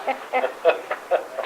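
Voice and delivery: breathy little laugh at the punchline; soft laugh. Real, not acted. Old man laughing at his mother's quip, soft chuckle. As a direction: chuckle at your own story.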